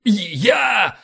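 loud, high military answer; full-voiced shout. A narrator's reading. First officer answers the roll call, shouting. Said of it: "в полный голос крикнул Боровский".

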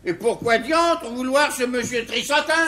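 indignant outburst. Acted. Father indignant at wife's choice.

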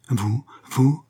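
mildly voiced startled disbelief; halting question, a touch of shock; startled cry. A narrator's reading. Young woman discovering the intruder, startled. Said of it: "s'écria enfin la jeune femme".

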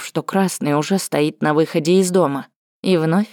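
plain reading voice

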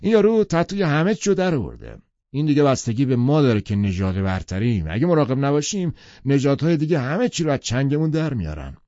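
Tom, loud, brushing her off; impatient. A narrator's reading. Tom impatiently answering Daisy, loud and insistent.

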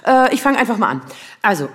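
ordinary talk

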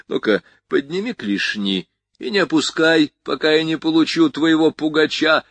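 a hard-bitten gun moll barking hands up; gun-girl giving orders. Acted.

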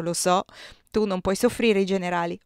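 usual speaking voice

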